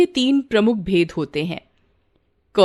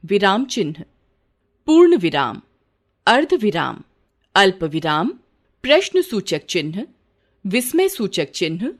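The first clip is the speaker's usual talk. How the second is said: normal talking voice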